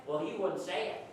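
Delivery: usual speaking voice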